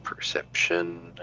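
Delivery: whispered low under the breath. Shown as a demonstration. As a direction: breathe the word out as a secret. Rolls dice, then whispers it.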